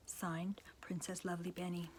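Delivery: soft whispered sign-off; whispered signature. Acted. Proclamation ends with the princess's name, whispered. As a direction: drop to a hush for the name.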